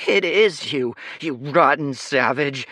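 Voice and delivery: high, loud sniveling noble brat. A narrator's reading. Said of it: "Leo sniveled".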